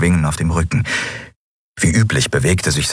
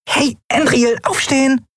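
tiny piping high squeak; squeaky little voice. Acted. Nelen squeaks to wake Endriel, piping high. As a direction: squeak her name like a tiny creature.

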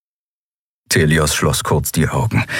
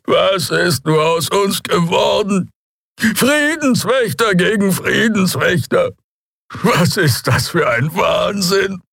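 high, slow alien lament; tearful Draxyl; 13 semitones higher, slower than usual. Acted. Old reptile appealing to the admiral, tearful. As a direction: lament slowly in a high, anguished voice. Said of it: "war voller Tränen".

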